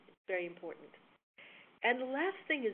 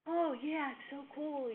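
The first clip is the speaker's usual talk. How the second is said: higher, lightly voiced crowd reaction; impressed audience; 6 semitones higher, slower than usual. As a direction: mimic the delighted listeners. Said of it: "People were like".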